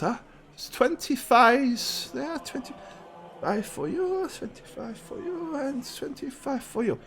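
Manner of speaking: high, slow priest counting out coins. Acted. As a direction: dole out each share slowly in a high voice.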